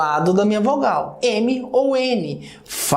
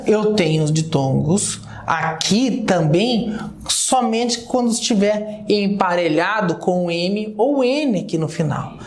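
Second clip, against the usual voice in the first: ordinary delivery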